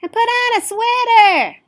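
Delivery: high, loud motherly call; a worried mom calling after her daughter; mother shouting a reminder skyward; fussing mother. Acted. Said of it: "her mother would add".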